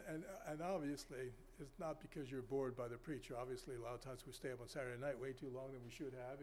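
ordinary talk, nothing marked

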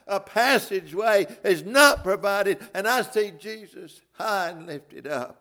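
high, loud, building preacher's cry; fervent preaching. Acted. Preacher crying out to congregation, rising fervor. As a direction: preach it up to the peak.

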